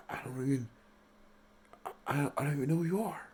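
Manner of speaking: hushed, halting impression, words barely getting out; barely breathing, barely speaking. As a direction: imitate an actor too stunned to breathe. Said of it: "barely breathing, barely speaking".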